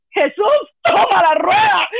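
high, loud, slow teary shout; a wailing shout of Jesús, toma la rueda; desperate cry. Retold or imitated. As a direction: cry out to heaven in despair. Retold desperate prayer.

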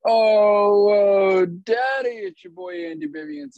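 loud, high hype yell; shouted catchphrase. Acted. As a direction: shout your intro like a hype man. Catchphrase shouted in show intro.

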